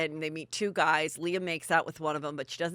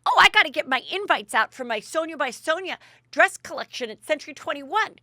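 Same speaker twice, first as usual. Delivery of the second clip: high, louder imitation of someone busy; retold quote, pitched up and fussy; acted-out bustle; 10 semitones higher, 7 dB louder than usual. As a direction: play up the rush of things to do. Retold Sonja announcing her busy task.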